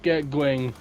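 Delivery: ordinary talk, nothing marked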